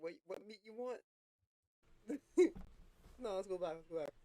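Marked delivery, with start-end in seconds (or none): 2.1-3.1 burst of laughter. Real, not acted. Cracking up at the clip.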